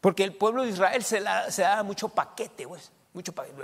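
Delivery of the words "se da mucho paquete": ordinary delivery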